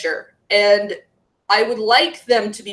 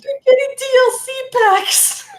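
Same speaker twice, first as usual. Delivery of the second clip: very high put-on squeal of complaint; mock outcry; 12 semitones higher than usual. Co-host jumping in about endless series, mock high-pitched outcry.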